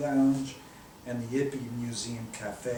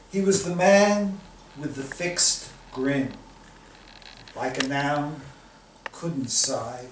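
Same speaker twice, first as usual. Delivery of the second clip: loud, emphatic spoken-word delivery; poem performance; 6 dB louder, slower than usual. Acted. Poet performing his poem to the reading crowd, emphatic. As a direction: pound out the poem's lines with force.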